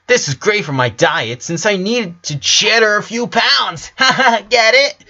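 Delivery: high, chirpy joke-teller; little sister laughing at her own joke; punning sister voice. A narrator's reading. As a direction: sell the cheesy pun with a laugh. Sister telling a pun.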